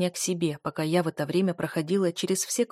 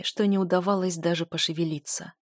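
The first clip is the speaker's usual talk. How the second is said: usual speaking voice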